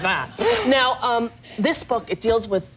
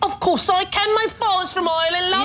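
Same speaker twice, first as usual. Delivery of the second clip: put-on high, hammy London cockney accent; 10 semitones higher than usual. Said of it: "Can you do a London accent?".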